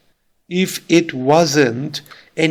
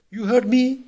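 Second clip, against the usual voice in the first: surprised check, voice going up; rising question tune; 8 semitones higher than usual. Shown as a demonstration. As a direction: pitch climbing high through heard me. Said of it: "through the rising tune that it is a question".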